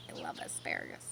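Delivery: whispered softly; tiny whisper; whispered aside. Real, not acted. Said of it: "aside to listeners".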